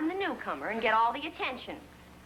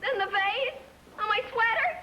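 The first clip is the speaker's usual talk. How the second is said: building, high-pitched melodramatic alarm; mounting alarm; 7 semitones higher than usual. Acted. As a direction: pitch climbing with each item. Mounting panic over damaged things.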